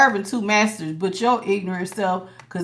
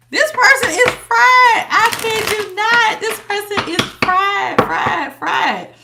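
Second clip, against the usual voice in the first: high, slow, astonished declaration; amazed exclamation; 9 semitones higher, slower than usual. Real, not acted. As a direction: marvel at how fried this person is. Reacting to a tarot card spread.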